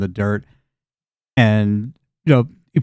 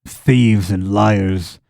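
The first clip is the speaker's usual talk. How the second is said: low-pitched, deliberate contempt; simple farmer, bitter; 4 semitones lower than usual. Acted. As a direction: air a farmer's grievance, measured and grim.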